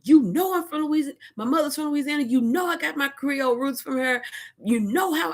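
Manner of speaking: very high, heated pleading rant; throwing a fit. Retold or imitated. Telling her husband she's from Louisiana, high heated fit. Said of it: "just throwing off fits".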